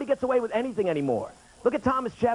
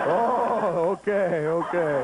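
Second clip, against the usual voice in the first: father; much slower than usual. Retold or imitated. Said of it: "father reacts".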